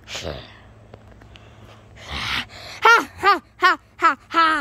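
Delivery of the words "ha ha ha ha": loud, high hammed-up ha ha laugh; mock evil laugh. Plushie laughing after kicking Among Us, loud mock villain laugh. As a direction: cackle like a cartoon villain.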